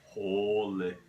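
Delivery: drawn-out Alaskan "Holy." with the "shit" dropped; a light Alaskan local's "Holy."; Alaskan local accent. Retold or imitated. Mimicking the locals' favorite line in an Alaskan accent. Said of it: "my favorite line that they always say is".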